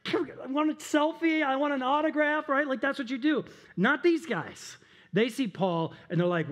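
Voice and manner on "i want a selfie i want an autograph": high-pitched mock fan begging; starstruck fan. Fan meeting a celebrity at the airport, starstruck pleading. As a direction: play an eager fan, voice pitched way up.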